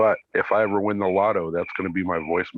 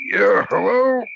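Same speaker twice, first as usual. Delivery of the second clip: attempted deep voice that goes much higher and louder; put-on deep voice; 7 dB louder, much slower than usual. Shown as a demonstration. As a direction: try to sound deep but it squeaks up high. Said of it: "kinda deepen your voice a little bit".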